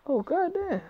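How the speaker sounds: high, drawn-out oh goddamn; stunned reaction. Real, not acted. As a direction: gasp it out, shocked. Reader reacts to story.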